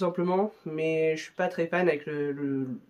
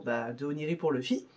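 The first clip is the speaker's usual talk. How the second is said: ordinary delivery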